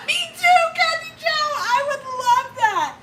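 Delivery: high, loud laughing squeal. Real, not acted. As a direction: burst out laughing and squeal your delighted agreement. Laughing reply to friend's comment.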